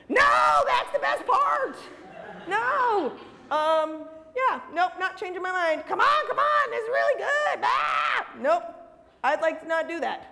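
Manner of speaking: hammed-up outrage, high-pitched; squeaky cartoon fury at the doubter; mock angry shout. Angry pirate fan again.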